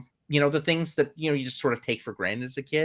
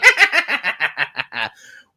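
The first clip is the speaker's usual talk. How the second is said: laughing; 8 semitones higher, 9 dB louder than usual. Real, not acted. Laughs after the witch read.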